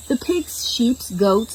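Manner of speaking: ordinary delivery, nothing marked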